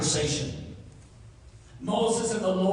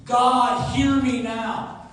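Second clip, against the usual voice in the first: loud, urgent appeal, slower on "hear me now"; pleading Moses; 5 semitones higher, 8 dB louder, slower than usual. Acted. Moses cries out to God for favor. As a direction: cry out to be heard. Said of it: "Moses sought favor with God".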